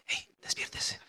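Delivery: whispered soft wake-up; gentle whisper. Shown as a demonstration. Showing how he gently wakes people, whispered. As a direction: whisper it like waking someone kindly. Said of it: "yo levanto a la gente muy como".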